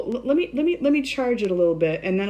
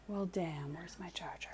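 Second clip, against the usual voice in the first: whispered to herself under breath; barely-there whisper while searching; 17 dB quieter than usual. Real, not acted. Muttering to self, searching charger.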